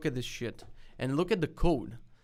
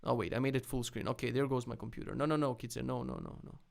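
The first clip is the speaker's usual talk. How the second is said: usual speaking voice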